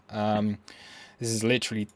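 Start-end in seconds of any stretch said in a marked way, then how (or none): none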